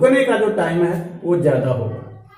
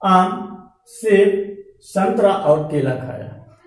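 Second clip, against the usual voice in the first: slow, short break after आम and सेब; pausing at commas; slower than usual. Shown as a demonstration. Teacher repeats the list in Hindi with pauses. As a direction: let each fruit stand apart. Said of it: "pausing demo in Hindi".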